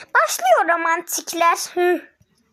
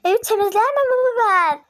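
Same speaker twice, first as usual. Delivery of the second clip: higher, whiny protest; indignant whine; 7 semitones higher, 3 dB louder than usual. Real, not acted. Refusing to clean the house, whining protest. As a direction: protest as if wronged.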